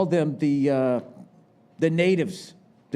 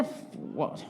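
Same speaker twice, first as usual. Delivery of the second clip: unvoiced mocking "What?"; breathy mock of the deaf crowd; mock whisper; 3 semitones lower, 13 dB quieter than usual. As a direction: whisper it like someone who can't hear. Mocking audience who can't hear.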